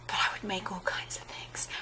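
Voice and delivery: whispered aside; whispering. Real, not acted. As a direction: drop to a whisper. Aside about sponsorship wish.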